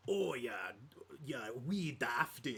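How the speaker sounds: light, drawn-out mock Scottish lilt; a hint of weird Irish-Scottish dwarf brogue. Showing how fantasy dwarves always sound, mock Scottish. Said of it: "Scottish, or, like, like".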